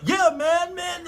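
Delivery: high-pitched hyped-up dude; excited confirmation. Acted. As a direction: assure the stunned crowd, thrilled. Confirming the shocking win to crowd.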